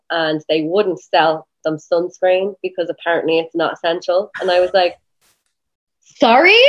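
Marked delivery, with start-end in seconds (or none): 6.0-6.6 high, loud, disbelieving query; acted-out affronted double take; incredulous question. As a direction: question it in disbelief, pitch shooting up. Acted reaction to absurd shop rule.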